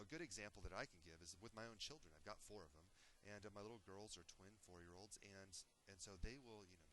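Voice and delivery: usual speaking voice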